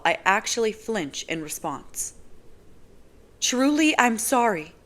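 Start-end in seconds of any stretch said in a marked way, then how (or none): 3.4-4.7 higher, awkward apology; embarrassed girl apologizing; apologetic young woman. Acted. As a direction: sound sorry and out of your depth. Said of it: "she says again".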